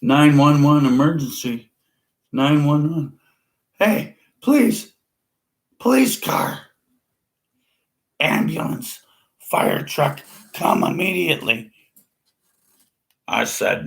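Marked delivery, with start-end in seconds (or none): 0.0-11.7 frantic caller. Retold or imitated. Mocking an emergency call.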